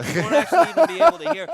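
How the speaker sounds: very high, loud, hurried squeal; comically squeaky and rushed; dog-whistle high tone. Pretend. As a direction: push the words up toward dog-whistle pitch. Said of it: "It'll be so high tone".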